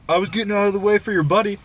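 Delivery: high-pitched imitation of his excuse; mocking retold excuse. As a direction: mimic him defending himself.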